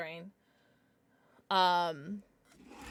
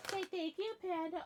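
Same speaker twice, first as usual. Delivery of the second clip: sweet pet voice; 9 semitones higher, 8 dB quieter than usual. Real, not acted. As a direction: coo at the pet. Talking to her pet.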